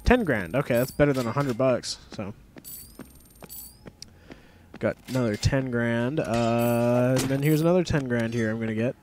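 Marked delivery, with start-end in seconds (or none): none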